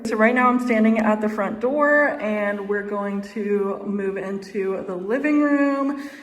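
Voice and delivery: normal talking voice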